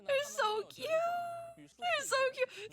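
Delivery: very high, slow squeal; squealing cute. Real, not acted. Streamer squealing over the Melusine, very high and drawn out. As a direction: melt over how cute they are.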